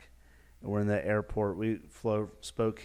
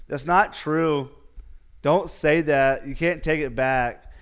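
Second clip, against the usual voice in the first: pleading; 4 semitones higher, 8 dB louder, slower than usual. Acted. Pleading with the angry skier.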